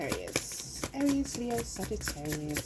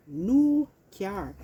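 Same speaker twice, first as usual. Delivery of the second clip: much louder, punchy repeat of New car; excited; 2 semitones higher, 9 dB louder than usual. Real, not acted. Tarot reader excitedly repeating the card's message: New car. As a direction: hype up the New car announcement.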